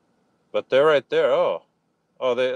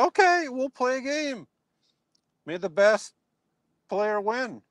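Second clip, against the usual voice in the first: a lightly higher, friendly challenge; retelling his own reply; 9 semitones higher than usual. Retold or imitated. Telling the trash-talking kid about their game, a mildly raised good-sport voice. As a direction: play the good sport accepting a game, half-mocking. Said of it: "I said".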